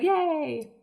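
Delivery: high, drawn-out mock yay; crowd cheer. Retelling the convention crowd's reaction, mock cheer. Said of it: "everyone's like".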